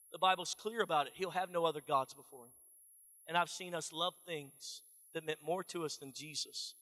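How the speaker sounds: ordinary talk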